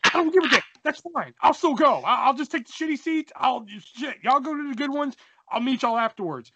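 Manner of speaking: high, worked-up bargaining; insistent pleading. Retold or imitated. Teen begging buddy to let him come, insistent.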